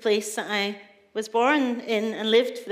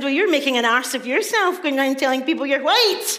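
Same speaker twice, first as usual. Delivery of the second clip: louder, higher mocking comeback; retold retort; 4 semitones higher, 7 dB louder than usual. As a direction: throw the scolding back, punchy and raised. Throws brother's words back at him.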